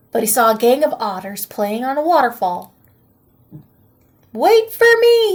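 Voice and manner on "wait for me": much higher, loud call; eager cry. A narrator's reading. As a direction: call out after the otters. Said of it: "he cried".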